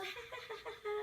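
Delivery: a shy little laugh held back. Shown as a demonstration. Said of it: "let's say you're feeling shy".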